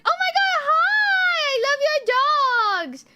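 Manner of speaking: very high, loud mock squeal, last words drawn out; gushing neighbor imitation. Neighbor stopping her on a dog walk, imitated gushing squeal.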